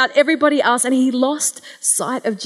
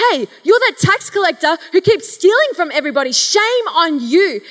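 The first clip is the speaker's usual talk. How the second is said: scolding Jesus; 5 semitones higher than usual. Retold or imitated. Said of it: "expected Jesus to scold him".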